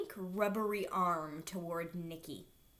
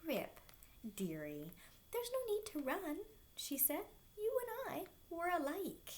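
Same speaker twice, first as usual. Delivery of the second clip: sweet-talking villain; 9 semitones higher, 7 dB quieter than usual. A narrator's reading. Villain coaxing Rip sweetly.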